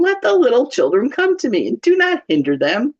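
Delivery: high, emphatic indignation; indignant. Acted. Jesus rebukes disciples for turning children away, indignant. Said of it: "he was indignant".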